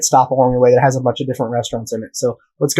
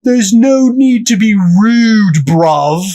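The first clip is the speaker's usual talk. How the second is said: high, drawn-out London yell, mock-belligerent; thick British accent yell; 7 semitones higher, 5 dB louder, much slower than usual. Angry London customer to McDonald's manager, mock British yelling. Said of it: "very thick British accent, and this guy is yelling".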